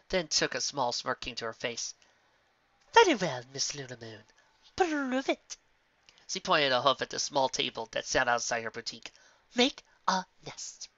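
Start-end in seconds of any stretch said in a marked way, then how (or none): 2.9-5.6 lightly voiced smug lady; a hint of a prim challenge. 9.5-10.9 slow, high prim order; slow command. Acted. Rarity command.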